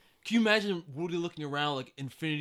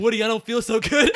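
laughing mock-dying Buzz; 5 semitones higher, 10 dB louder than usual. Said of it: "Buzz is like".

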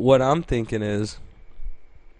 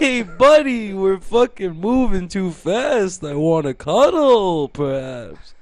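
raised, drawn-out Italian protest, tapering off; mock Italian guy; 7 semitones higher, slower than usual. Italian driver wanting to cuddle, mock indignant accent. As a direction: protest as a tender-hearted Italian tough guy. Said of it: "sounds like Italian people".

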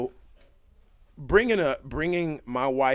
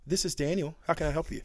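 polite professional phone voice; 2 semitones higher, 3 dB quieter than usual. Acted. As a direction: answer the call like a courteous professional.

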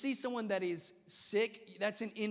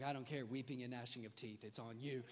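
much lower and softer grim mock preacher; imitating evangelist; 8 semitones lower, 10 dB quieter than usual. Evangelist dismissing the nine-year-old, low and grim. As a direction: growl the doom flatly. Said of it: "He's like".

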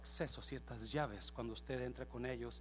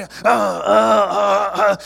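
loud, high repeated grunts; battering effort yells; 6 semitones higher, 25 dB louder, much slower than usual. Acted. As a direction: yell with each blow at the door. Big dog hitting the door. (gritos fuertes al golpear)

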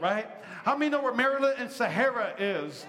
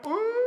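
a sharp, much higher ooh of warning; high mock ooh; 12 semitones higher, 4 dB louder than usual. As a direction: squeal it like a worried neighbor lady. Ladies react to visitors.

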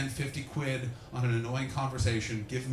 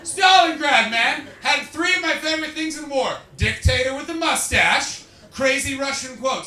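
higher and louder, gleeful; excited; 9 semitones higher, 7 dB louder than usual. Real, not acted. Comic delighted by a student's quick history answer, excited shouting. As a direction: cheer her answer like a fan.

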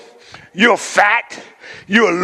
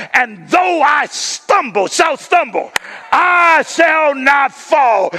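higher, ringing preacher's cry; preaching at full force; 4 semitones higher than usual. Real, not acted. Preacher giving the church scripture to declare, full force. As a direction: rise to a triumphant declaration.

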